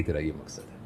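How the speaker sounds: hushed, low voice from within; inner voice. Acted. Inner voice answering someone sitting in silence, hushed and low. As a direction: drop right down, soft and low. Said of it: "اس کے اندر سے آواز آ جائے گی".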